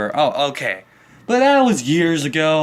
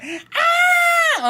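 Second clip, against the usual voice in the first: demo scream. Shown as a demonstration. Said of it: "I'm yelling, but I'm not".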